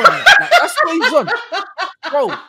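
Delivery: high laugh through the words. Real, not acted. Cracking up at the Todd picture, laughing. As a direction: laugh it out, can't hold it in.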